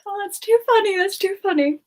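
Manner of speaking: high giggly that's too funny; laughing. Real, not acted. Streamer cracking up at chat's frog joke, laughing. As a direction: speak through giggles.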